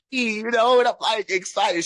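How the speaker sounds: high-pitched retold excitement; loud, high thrilled aside; excited.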